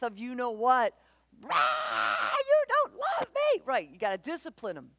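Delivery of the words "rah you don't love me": hammed-up child tantrum cry; mock child tantrum. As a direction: play a furious kid wailing at the parent. Child's outburst after being told no.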